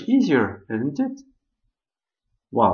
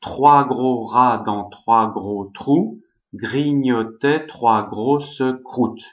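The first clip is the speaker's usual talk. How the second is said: slow, emphatic French tongue twister; tongue-twister demo; 2 semitones lower, slower than usual. Shown as a demonstration. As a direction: pronounce each French word slowly and deliberately, with rolled-back French R. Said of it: "Try to say".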